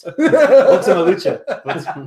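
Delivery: slow, drawn-out epic announcer; fighting game announcer. Acted. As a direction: boom the title out slowly. Echoes the announcer bit.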